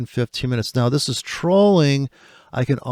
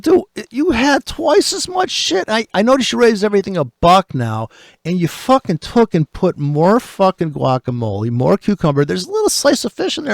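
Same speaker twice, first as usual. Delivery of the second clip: higher, indignant retold rant at the sushi chef; mock outraged customer; retold complaint; 6 semitones higher than usual. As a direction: complain about the tiny fish, incredulous. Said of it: "I said".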